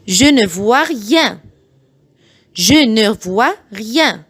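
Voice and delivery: very slow, each syllable spelled out; slow it right down so parents can copy; slow model sentence for learners; slow teaching read. Shown as a demonstration. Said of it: "بعيد الجمل بالراحة جدًا".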